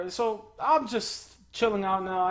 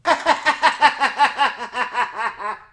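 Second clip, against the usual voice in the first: big loud laugh bursting out; loud burst of laughter; 5 semitones higher, 12 dB louder than usual. Real, not acted. Loud laugh without words.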